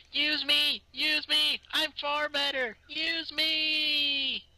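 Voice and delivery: high, begging "use me"; pleading app voice. Retold or imitated. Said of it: "Skype's like".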